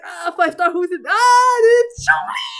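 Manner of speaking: loud high cry building to a shriek; thrilled yell, last words stretched out; excited screaming. Retold or imitated. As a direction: build suspense, then scream the reveal. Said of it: "It's just me screaming half the time".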